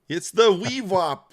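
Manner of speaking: wewop said wee-wop, high and loud; coaching a friend to say wewop; mock pronunciation. Shown as a demonstration. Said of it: "I'm trying to get Tom to say it".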